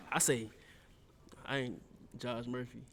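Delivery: ordinary talk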